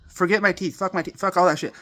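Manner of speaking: ordinary talk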